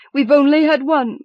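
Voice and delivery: put-on high, loud frantic old woman; urgent, feverish rush; frantic excitement. A narrator's reading. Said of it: "she replied rapidly".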